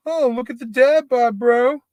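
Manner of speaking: very high mock bro yelling about dad bods. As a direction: ham up a taunting gym bro.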